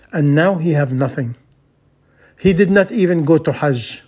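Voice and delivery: normal talking voice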